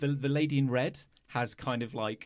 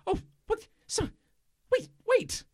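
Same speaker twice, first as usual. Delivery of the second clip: very high, startled cry of wait; alarmed Mike. Acted. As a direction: yelp it high in sudden alarm. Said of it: "you hear him go".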